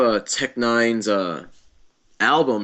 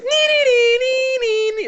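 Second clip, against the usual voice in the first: very high falsetto nee-nee chant; high squealing theme; 4 dB louder than usual. Retold or imitated. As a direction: squeal it like a mocking theme tune. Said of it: "start going like".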